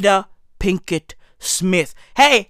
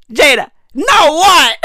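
laughing shout; 9 semitones higher than usual. Real, not acted.

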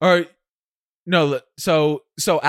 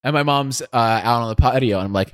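normal talking voice

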